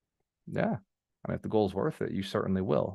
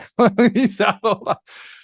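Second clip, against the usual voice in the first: high burst of laughter; bright laugh after an outburst; sheepish laugh; 11 semitones higher, 7 dB louder than usual. Real, not acted. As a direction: laugh it off, a little embarrassed.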